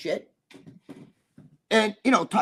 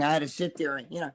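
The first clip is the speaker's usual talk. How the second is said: ordinary delivery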